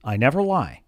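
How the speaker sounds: low, soft, unhurried reassurance; calm and steady. Retold or imitated. Superman calmly assuring Lois, low and soft. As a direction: say it calmly, as simple fact. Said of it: "very calmly says".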